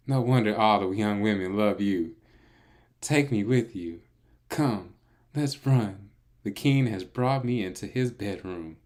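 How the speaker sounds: lady voice. Acted. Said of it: "we gotta do the lady voice".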